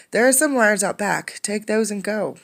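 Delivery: high, loud brusque dismissal; gruff miller. Acted. Miller brusquely sending Condi out back. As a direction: wave him off impatiently.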